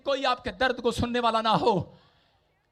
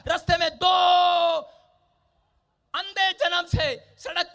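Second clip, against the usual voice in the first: raised, projected sermon storytelling; full-force preaching; 8 semitones higher, 5 dB louder than usual. Acted. Preacher telling of two blind beggars by the road, projected preaching. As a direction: carry the story out to the congregation.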